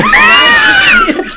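high, loud squealing laugh; shrieking laughter. Real, not acted. Cracking up at the co-host's joke, shrieking laughter. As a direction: burst out in a piercing laugh. Joking mention sets off laughter.

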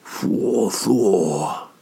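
mock swooning at a crush; lustful swoon. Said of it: "they go".

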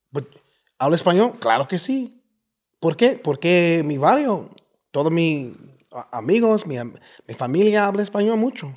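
high, loud, animated Mexican barrio Spanish; excited Mexican-accented Spanish; family's Mexican Spanish. Shown as a demonstration. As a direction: fire off Spanish like the Mexican neighborhood back home. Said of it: "that's how my family spoke".

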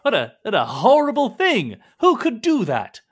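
high, loud, appalled gasping words; shocked outrage. Acted. Merchant hearing of slaving, loud shocked outrage. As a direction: recoil in mock horror.

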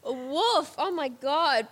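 normal talking voice

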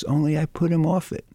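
usual speaking voice

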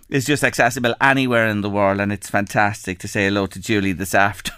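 ordinary talk

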